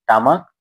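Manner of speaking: টামাক with a hard retroflex ট at the start; টামাক with a hard ট sound; mispronounced tamak. Shown as a demonstration. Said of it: "তামাককে কি বলে?".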